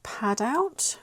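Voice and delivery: ordinary delivery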